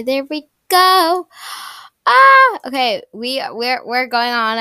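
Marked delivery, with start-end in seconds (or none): 2.1-2.6 high loud squeal of delight; excited squeal. Real, not acted. Girl squealing at her new outfit, high excited squeal. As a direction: let out a thrilled squeal.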